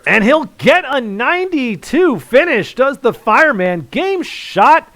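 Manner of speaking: very loud, high-pitched commentator's roar; real big-moment darts call at full voice; excited winning call. Real, not acted. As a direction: shout the checkout home. Commentator calls the winning checkout.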